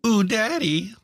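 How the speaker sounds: high, loud teasing coo of "Ooh, Daddy"; mock sultry coo. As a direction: coo it like a flirty tease, hamming it up. Teasing partner with a flirty quote.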